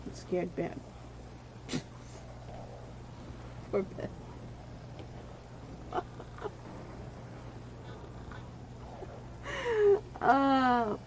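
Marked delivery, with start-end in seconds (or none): 0.8-3.7 soft unvoiced chuckle; a hushed laugh to yourself; quiet breathy laugh. Real, not acted. Laughs about scaring Ben. 4.1-10.1 high, breathy, long wheezing laugh; long breathless laugh. Real, not acted. Long laugh about scaring Ben.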